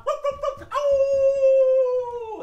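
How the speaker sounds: high yips into a long howl; yap, then howl loudly and hold it; dog bark and howl. Pretend. Puppet dog leaves the kids, barking and howling.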